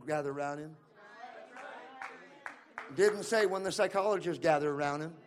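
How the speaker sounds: loud, high, driving preacher's cry; full-force preaching. Acted. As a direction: preach it to the rafters, building the room up.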